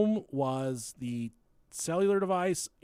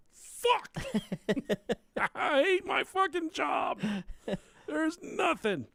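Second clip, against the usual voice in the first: frustrated detective; 13 semitones higher, slower than usual. Pretend. Detective venting at his hopeless case, high frustrated yelling. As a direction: blow up like a detective with no leads. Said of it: "As a detective in this case, you're like".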